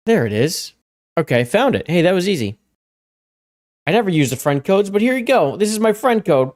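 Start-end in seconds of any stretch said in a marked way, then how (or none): none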